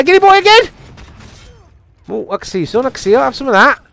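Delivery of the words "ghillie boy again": high, loud gleeful call; delighted squeal. Real, not acted. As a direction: squeal at spotting your favourite victim. Spots the same victim again.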